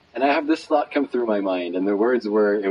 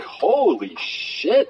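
slow, higher, drawn-out astonishment; stunned amazement; 8 semitones higher, much slower than usual. Retold or imitated. Storyteller struck by the effect of his gift, slowly amazed. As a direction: stretch each word out in awe. Teller stunned by the man's joy.

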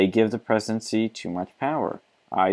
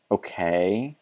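ordinary talk

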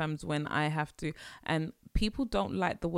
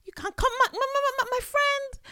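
very high, loud, stammering indignation; outraged parent; 6 dB louder than usual. Acted. Parent refusing a child's complaint, high spluttering outrage.

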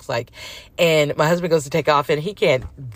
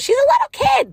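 put-on high squeaky kid voice, much higher; mimicking the sister; 5 dB louder than usual. Sister defending child, mimicked.

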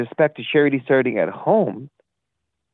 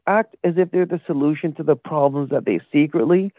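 ordinary delivery

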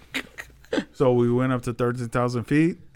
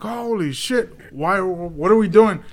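retold panic; 6 semitones higher, 4 dB louder, slower than usual. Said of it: "I'm like".

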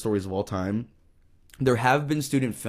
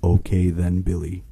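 under-bed monster; 4 semitones lower, 5 dB louder than usual. Acted. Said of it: "said a voice from under the bed".

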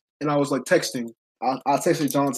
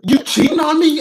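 accusing; 12 semitones higher, 7 dB louder than usual. Retold or imitated. Girlfriend accuses him.

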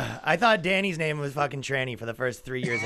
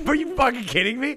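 incredulous; 10 semitones higher than usual. Retold or imitated. Mocking the accent denial.

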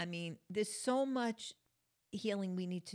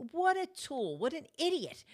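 higher, louder scoff at a careless driver; irritated mock insult; 8 semitones higher, 4 dB louder than usual. About the badly parked driver, annoyed higher scoff. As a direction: snap it out in disbelief. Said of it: "I'm like".

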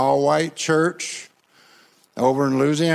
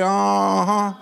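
call and response; 3 semitones higher than usual. Shown as a demonstration. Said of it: "Come on, everybody say".

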